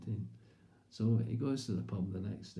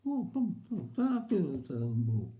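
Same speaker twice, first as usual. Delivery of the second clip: toothless mumble; 8 semitones higher, 4 dB louder than usual. Retold or imitated. Toothless husband answers.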